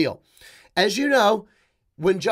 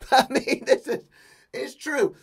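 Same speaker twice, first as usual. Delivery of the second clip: higher, laughing through the words; real laughing; 5 semitones higher than usual. Real, not acted. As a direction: chuckle while insisting on it. Laughs at his own point.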